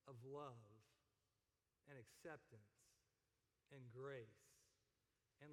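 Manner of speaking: soft, low preaching with long pauses; gentle, low closing appeal; hushed sermon close. Real, not acted. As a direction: let each phrase land quietly, low and unhurried.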